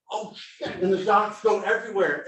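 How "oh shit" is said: mock startled curse. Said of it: "you just see me go".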